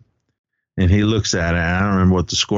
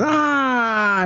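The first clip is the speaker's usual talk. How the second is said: high, drawn-out "ahh" cheer; mock crowd cheer. Everyone cheering after the teacher's scolding, imitated high cheer. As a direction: imitate a cheering crowd without full volume. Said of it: "everyone's cheering and laughing".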